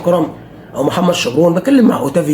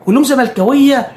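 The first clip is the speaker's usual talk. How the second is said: ordinary talk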